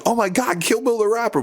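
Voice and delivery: starstruck fan. Retold or imitated. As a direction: squeal like an excited fan. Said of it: "They just see me".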